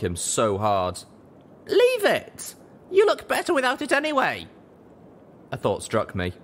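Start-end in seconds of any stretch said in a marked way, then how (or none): 1.7-4.5 high, louder exasperated pony voice; higher, louder exasperated mare. Acted. Heroine snapping at Calamity about his helmet, high and exasperated. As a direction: cut him off, impatient, pitched up high.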